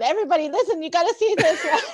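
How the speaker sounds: high-pitched, giggly imitation of excited teachers; retold excitement, cracking up; laughing summons. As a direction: call the others over through laughter. Acting out laughing teachers summoning others.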